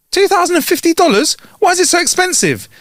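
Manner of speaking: high-pitched incredulous outburst; acted shock, pitch shooting up; shocked disbelief. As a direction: balk at an outrageous bill. Shocked customer balks at the bill.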